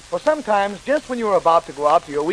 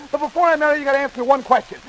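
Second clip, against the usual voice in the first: high rapid-fire list patter; as fast as possible; 4 semitones higher, faster than usual. Shown as a demonstration. Storyteller racing through the basket's contents, rapid-fire. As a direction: reel the whole list off at speed. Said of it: "just as fast as I can tell it".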